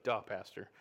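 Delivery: sarcastic listener. Retold or imitated. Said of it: "You're like".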